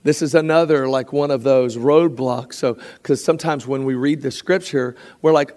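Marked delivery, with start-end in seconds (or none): none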